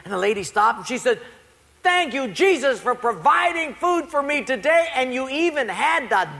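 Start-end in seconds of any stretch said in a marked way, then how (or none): 1.8-6.3 high, grateful proclamation; undeterred praise. Acted. Poor lady answering the atheist by thanking Jesus, undeterred praise. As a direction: imitate a devout lady praising aloud. Lady's triumphant answer in prayer.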